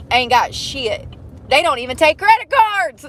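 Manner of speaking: ordinary talk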